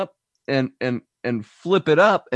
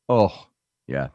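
usual speaking voice

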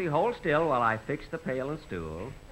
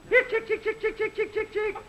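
high calling voice; calling chickens. Acted. Feeding the chickens.

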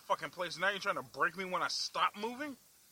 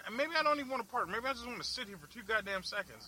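normal talking voice

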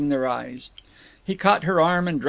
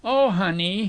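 mild wail, raised pitch; 4 semitones higher than usual. A narrator's reading. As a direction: wail it a little. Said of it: "he wailed in youthful".

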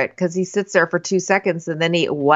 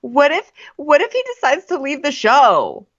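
mocking the cast fretting he'll quit; mock panic; 6 semitones higher than usual. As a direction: gasp it out, hammed-up worry. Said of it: "they're all like".

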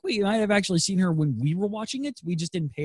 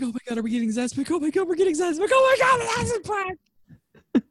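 rising excitement. Retold or imitated. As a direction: get more thrilled each phrase. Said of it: "I'm like".

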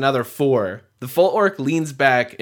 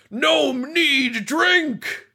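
golem yelling; 11 semitones higher, much slower than usual. Acted. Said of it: "starts yelling really, really loudly".

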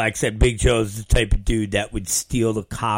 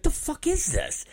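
incredulous; 7 dB quieter than usual. Retold or imitated. His reaction to bootleg photo.

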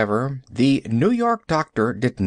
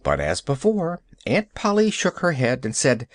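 ordinary delivery, nothing marked

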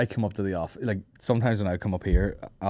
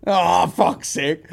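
exasperated; 8 semitones higher, 4 dB louder, much slower than usual. Real, not acted. Reacting to the story.

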